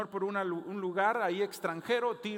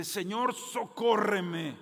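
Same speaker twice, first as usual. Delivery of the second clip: pleading; 3 semitones higher, 6 dB louder, much slower than usual. Retold or imitated. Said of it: "se tira ante los pies del Señor y le dice".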